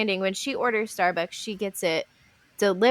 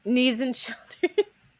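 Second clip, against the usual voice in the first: laughing repeat; 4 semitones higher, slower than usual. Real, not acted. Repeating dance phrase laughing.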